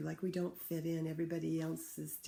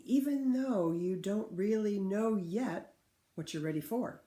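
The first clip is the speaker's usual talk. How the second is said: louder, slower read-aloud with rising resolve; story reading; 6 dB louder, slower than usual. Acted. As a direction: build it up, brave and steady. Teacher reads picture book aloud.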